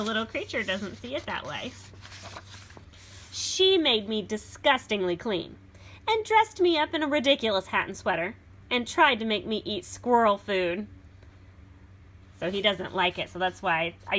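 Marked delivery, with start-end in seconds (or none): 3.3-10.9 put-on lively read-aloud for children; storybook reading. A narrator's reading. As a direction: read it like a picture book to a class. Reads beast's version.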